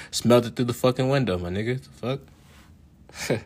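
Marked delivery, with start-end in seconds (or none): none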